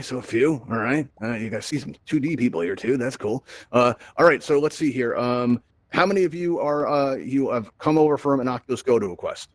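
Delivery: ordinary talk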